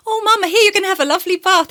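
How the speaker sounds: put-on jolly the old lady along, high and loud; cheery mum on the phone. Mum on the phone cheerfully coaxing nan, high and loud.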